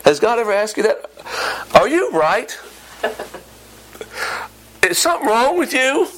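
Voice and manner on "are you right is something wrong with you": incredulous. Acted. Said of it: "it's probably something like".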